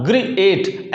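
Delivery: ग्रीएट broken in two, gree-ate; mispronounced ग्रीएट. Shown as a demonstration. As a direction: deliberately misread ग्रीएट with two separate vowel sounds. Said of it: "ऐसा तो नहीं इसको बोलते".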